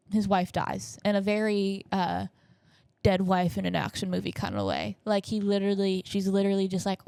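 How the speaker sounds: ordinary delivery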